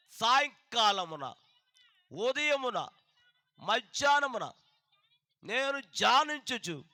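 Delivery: loud, slow solemn psalm reading; preacher reading Psalm fifty-five aloud; scripture reading. Acted. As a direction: proclaim the verse to the congregation.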